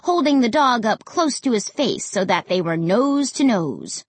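ordinary delivery, nothing marked

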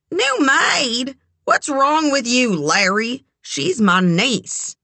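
high, affronted rebuke; offended aunt telling a man off; indignant. A narrator's reading. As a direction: repeat the insult in disbelief, then set him straight. Eleanor indignantly rebukes Larry.

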